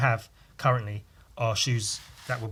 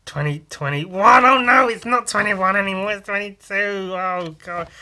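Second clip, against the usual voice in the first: louder, slowed, then higher fluster as the mistake dawns; flustered realising; 6 semitones higher, 7 dB louder than usual. Retold or imitated. Kid writing the date catches his mistake, flustered. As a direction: caught out mid-word, oh no. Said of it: "and you, you go".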